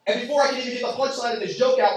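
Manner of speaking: usual speaking voice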